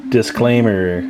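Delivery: normal talking voice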